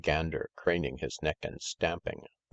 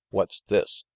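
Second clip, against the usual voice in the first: higher, louder indignant demand; loud, angry voice; 4 semitones higher, 6 dB louder than usual. A narrator's reading. Master bursts in on fighting animals, loud and angry. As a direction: break in sharply, angry and loud. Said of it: "They heard a loud, angry voice".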